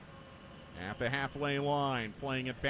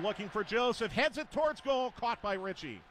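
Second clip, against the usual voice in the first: excited call; 6 semitones higher than usual. Real, not acted. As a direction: pitch jumps up on the header. Header toward goal.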